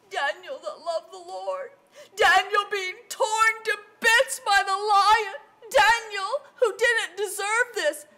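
high, loud, wailing lament; anguished king. Acted. King grieving over Daniel all night long, hammed-up anguish. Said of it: "He was so upset".

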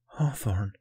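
whispered realization; stunned whisper. A narrator's reading. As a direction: whisper the name in disbelief. Stunned first take, then repeated.

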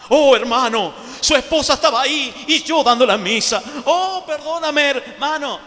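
a flustered priest begging pardon, pitched high; mock priest apology. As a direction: ham up the priest's embarrassed apology.

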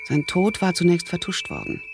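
usual speaking voice

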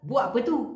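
"Buat apa tu" said clipped, with "apa" shortened to "pe" and a rising "tu"; short Malaysian speech. Shown as a demonstration. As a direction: run "Buat apa tu" together fast, like a local, ending on a rise. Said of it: "ঠিক এভাবেই short করে বলে". ("Buat apa tu" ছোট করে, শেষে "tu" উঠিয়ে)

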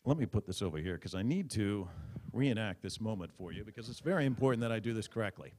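ordinary talk, nothing marked

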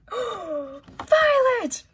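gasp, then a high, loud squeal; thrilled recognition. Real, not acted. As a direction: shriek the name in delight. Recognises character on new print.

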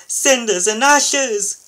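high, loud, slow exclamation; drawn-out shout of alarm; alarmed cry. A narrator's reading. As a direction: cry out as the crash looms. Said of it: "cried Thomas".

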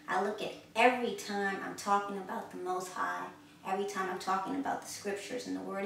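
ordinary delivery

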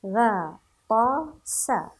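"غا، طا، سا" as separate, stressed long syllables. Shown as a demonstration. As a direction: sound out "غا، طا، سا" slowly, one at a time. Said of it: "كل الحروف نعرفها". (تهجئة "غا، طا، سا" مقطعًا مقطعًا)

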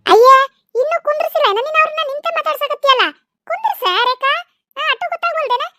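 high-pitched scolding, loud at the outset; animated, high reproach; chiding fuss. Acted. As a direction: fuss over a guest left standing. Elder returns, chides the young woman.

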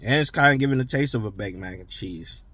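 talking with mouth full; speaking between bites; chewing food. Real, not acted. Seen 0: Bite taken at -7; closed mouth, head down while chewing to 2.6.

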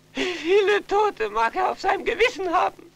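high, sobbing, rising to a cry on Alles; breaking down. Acted. Son cries out his guilt to his parents, sobbing. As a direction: guilt tearing the words out of him.

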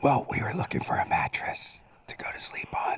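quiet breathy phone whisper. Pretend. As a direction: hush every word. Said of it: "I have to whisper".

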